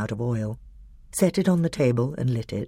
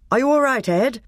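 concerned call; 10 semitones higher, 3 dB louder than usual. A narrator's reading. Boy calls out after the fight.

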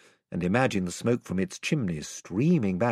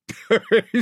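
a big, booming sailor's laugh; hearty laugh; 12 semitones higher, 7 dB louder than usual. Acted. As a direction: burst out laughing, high and loud. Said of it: "Drinian burst out laughing".

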